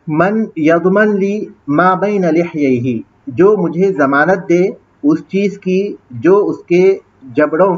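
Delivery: slow, spaced dictation of the phrase. Shown as a demonstration. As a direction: dictate it slowly for students to follow. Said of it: "teacher slowly dictating translation". (धीमे बोलकर लिखवाना)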